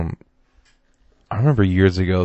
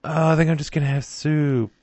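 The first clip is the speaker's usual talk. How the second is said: higher, put-on mocking voice; mocking soup eater; 7 semitones higher than usual. Mocking people who call soup dinner, put-on voice. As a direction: mimic a silly diner, pitched up.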